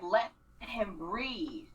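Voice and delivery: ordinary talk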